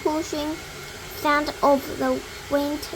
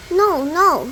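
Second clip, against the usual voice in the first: loud, raised refusal; yelled back; 3 semitones higher, 6 dB louder than usual. A narrator's reading. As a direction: shout it back over the noise. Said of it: "Mister Wonka yelled back".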